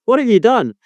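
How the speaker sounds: ordinary delivery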